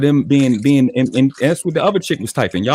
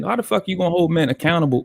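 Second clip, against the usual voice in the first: ordinary talk, nothing marked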